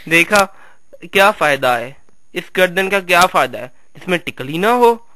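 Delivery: ordinary talk, nothing marked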